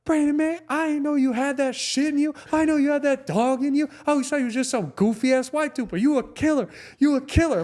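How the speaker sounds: high, loud falsetto hype; hyped-up impression of an amazed teammate; imitated awed teammate. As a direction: gush over an unlikely hero, high-pitched. Imitates awed teammates he hardly knows.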